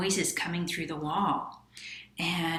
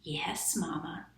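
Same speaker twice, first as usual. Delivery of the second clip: whisper; 6 dB quieter than usual. Acted. Quiet mice answer.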